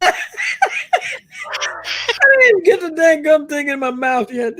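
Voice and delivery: high, laughing through every word; laughing too hard to get the words out. Real, not acted. Laughing at cookie joke.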